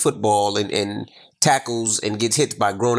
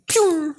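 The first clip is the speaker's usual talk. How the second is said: high whistling "pew"; imitated zoom-away noise. Parent mimics assistant fleeing bird, high whoosh. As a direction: make a quick getaway whoosh.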